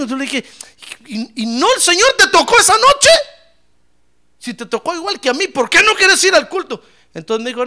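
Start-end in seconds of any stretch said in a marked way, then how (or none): 1.1-6.8 high, louder disbelieving challenge; retold indignant questioning. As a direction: confront a friend, pitch raised. Retold confrontation with friend. (reclamo indignado en tono agudo)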